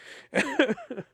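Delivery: sudden high-pitched burst of laughter; high squeaky laugh. Real, not acted. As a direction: laugh up high at your own bad luck. After learning his failing score.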